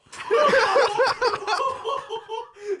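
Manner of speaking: very high, loud burst of laughing; explosive laughter. Real, not acted. Friends hearing the incinerator line, shocked laughter. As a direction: crack up helplessly and long.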